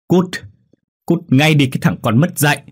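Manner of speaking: mother's sharp, scolding yell; furious dismissal. A narrator's reading. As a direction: order a disgraceful son out at once. Mother orders her son out.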